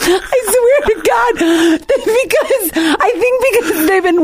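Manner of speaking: high voice breaking up with laughter; laughing helplessly. Real, not acted. Host defending her mistake, still laughing helplessly. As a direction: try to explain through the giggles.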